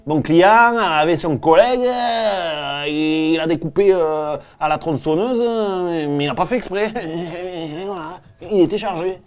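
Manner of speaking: put-on lawyer with a thick southern French accent. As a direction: plead the case with heavy southwest twang. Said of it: "qui a envie d'un avocat qui dit".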